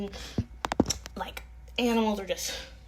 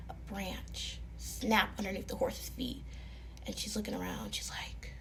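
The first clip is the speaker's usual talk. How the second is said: spooky hush; 9 dB quieter than usual. A narrator's reading. Suspense in dark foggy forest.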